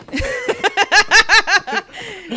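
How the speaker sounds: high, loud burst of laughter; real loud giggle. Real, not acted. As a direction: let a big high laugh out. Laughing after greeting viewer.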